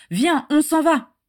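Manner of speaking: high, loud, drawn-out plea; urgent calling. A narrator's reading. Régulus urging Bella to leave, calling out. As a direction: call her to come away. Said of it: "appela Régulus".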